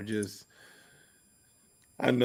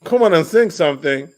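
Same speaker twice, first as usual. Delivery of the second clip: loud teasing; 6 semitones higher, 8 dB louder than usual. Retold or imitated. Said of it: "teasing call to sing".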